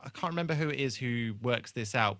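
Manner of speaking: usual speaking voice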